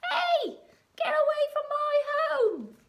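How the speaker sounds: high, loud squeaky mole with a laugh; grumpy mole. Acted. Mole telling the pirates to get off his home, squeaky and cross. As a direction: shoo the diggers off like a cross little mole. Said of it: "He's like".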